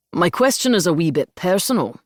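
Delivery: put-on lilting, heavy Scottish accent; thick Scottish brogue. A narrator's reading. Audience member putting a personal question, Scottish brogue. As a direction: ask it in broad Scots tones. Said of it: "in a thick Scottish brogue".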